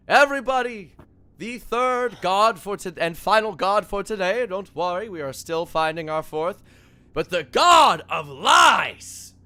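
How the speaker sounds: high, loud showman's announcement; announcer. Acted. Alistair presenting the final god to the crowd, booming announcer. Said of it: "Alistair on the other side goes".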